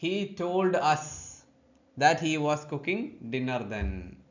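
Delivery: very slow example read, word by word; careful teacher reading; slow dictation. Shown as a demonstration. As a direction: read the corrected sentence slowly for the class. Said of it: "told ആണ് ഉപയോഗിക്കേണ്ടത്".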